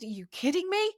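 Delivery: pitched far up, incredulous; can't believe what she's hearing; exasperated disbelief. Retold or imitated. To the man with his DNA supplements, exasperated disbelief. Her exasperated retort retold.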